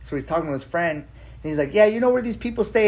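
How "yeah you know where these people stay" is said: high-pitched imitation of a rez phone chat; retold high, casual query; rez-style talk. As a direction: imitate a relative asking around, pitched up. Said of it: "this style of communication that I love".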